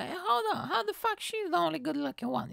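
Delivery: high mock voice of jealous women. Women in the audience, jealous, in a high mocking voice. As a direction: squeak it out like an offended woman. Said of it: "all the women will be like".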